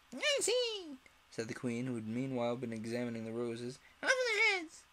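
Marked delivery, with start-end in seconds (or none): 0.1-1.0 very high, drawn-out royal voice; queen judging the roses; haughty Queen. A narrator's reading. 4.0-4.8 very high, loud royal sentence; queen ordering the beheading; furious Queen. A narrator's reading.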